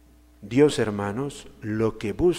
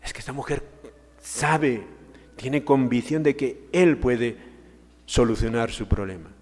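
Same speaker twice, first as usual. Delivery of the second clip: ordinary talk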